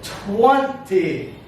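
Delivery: "Twenty" slowly, lifting the first syllable; stress on first syllable. Shown as a demonstration. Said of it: "It goes up".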